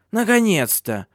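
put-on high, sharp old crone; sharp old woman. A narrator's reading. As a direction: snap it as an impatient old woman. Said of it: "резко произнесла старуха".

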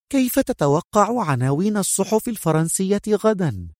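high, tender woman's question; gentle. A narrator's reading. Woman asking her partner about tomorrow's papers, gentle and high. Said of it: "تسأله في رقة".

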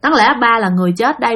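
ordinary delivery

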